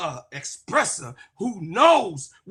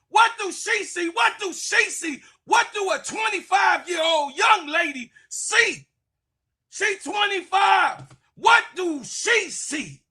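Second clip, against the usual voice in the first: incredulous shout; 6 semitones higher than usual. Real, not acted. Ranting at Candace about Satu Sabli.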